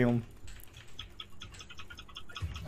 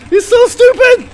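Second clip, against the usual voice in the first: exasperated shout; 13 semitones higher, 8 dB louder than usual. Real, not acted. Frustrated at the game.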